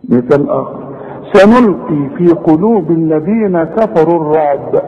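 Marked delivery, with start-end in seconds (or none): 1.3-4.8 slow recitation, final ب of الرعب bounced; qalqalah at the stop. Shown as a demonstration. Teacher showing how he stops on the ba, bounced الرعب. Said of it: "شوف أنا وقفت على الباء إزاي؟".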